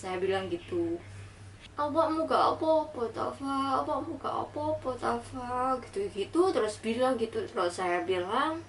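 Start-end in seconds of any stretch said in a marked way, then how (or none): 1.7-5.8 retold tearful worry, higher pitched; menirukan ibu menangis. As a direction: imitate a crying mother's anxious, high-pitched questioning. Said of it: "Ibu saya itu nangis sesenggukan". (menirukan ibu yang cemas sambil menangis, nada tinggi)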